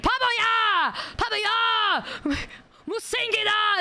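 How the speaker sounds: imitated high-pitched scolding in Korean; mock a bossy spa lady telling someone off; drawn-out, high mock berating; aggressive Korean scolding. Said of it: "the woman was so aggressive".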